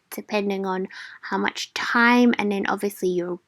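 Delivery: soft whispered afterthought; whispered aside. Real, not acted.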